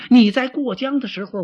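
high, loud protest; indignant retort. Acted. Deputy reminds the general of his earlier warning, indignant. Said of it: "心里可真有点不大痛快".

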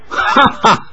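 breathy mock laughter ha ha; big laugh. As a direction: burst into a scornful laugh. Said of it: "十号大笑道".

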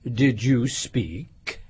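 icily courteous question; frosty politeness. A narrator's reading. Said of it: "frowning, but very politely".

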